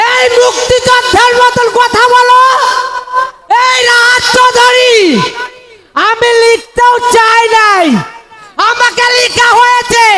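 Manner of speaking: high, pleading cries to father; anguished film hero. Acted. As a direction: wail it like a melodrama son. (উঁচু গলায় কান্নাভেজা আকুতি)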